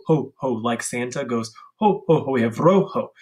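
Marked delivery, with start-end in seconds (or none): none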